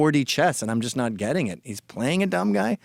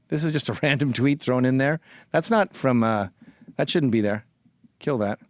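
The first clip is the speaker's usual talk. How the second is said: normal talking voice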